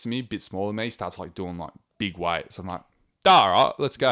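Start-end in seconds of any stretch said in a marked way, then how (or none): 3.2-4.1 loud, pumped-up call before a big lift; a retold gym yell, loud and high; hyped gym shout. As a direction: psych yourself up with a shout. Gym pump-up self-talk.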